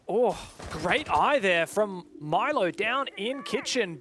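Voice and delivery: normal talking voice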